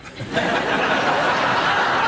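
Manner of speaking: loud real laughter; hearty laugh. Real, not acted. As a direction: burst out laughing. Laughs at own joke.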